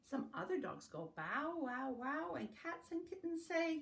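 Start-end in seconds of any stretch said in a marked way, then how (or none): none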